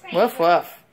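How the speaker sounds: falling, punchy woof like a playful dog; dog bark. Shown as a demonstration. Mother barks like a dog for child.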